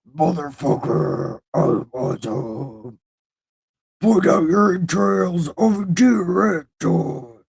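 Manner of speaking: growling death metal lyrics. Acted. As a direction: snarl it like a metal vocalist. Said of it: "I can kinda death metal".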